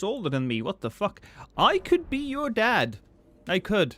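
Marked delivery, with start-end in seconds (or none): 1.6-3.9 high, slow hammed-up old man; mock dad voice. Streamer teasing younger viewer, hammed-up dad voice. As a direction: ham up the ancient elder. Joking about being older.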